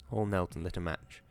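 ordinary delivery, nothing marked

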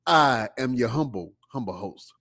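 ordinary talk

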